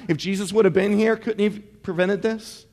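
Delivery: usual speaking voice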